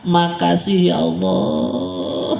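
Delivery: very slow, stretched-out thanks to God; drawn-out thanks. Shown as a demonstration. Preacher models thanks to Allah for congregation, drawn out. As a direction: draw out the vowels for all to follow. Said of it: "ini bahasa kita".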